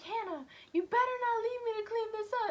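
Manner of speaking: panicked plea. Retold or imitated. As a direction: beg her not to abandon you.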